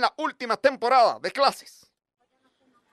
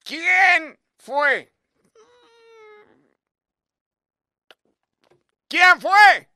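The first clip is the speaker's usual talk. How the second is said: demanding who; 4 semitones higher, 3 dB louder, much slower than usual. Retold or imitated. Teacher demanding culprit.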